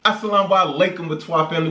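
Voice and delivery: ordinary talk, nothing marked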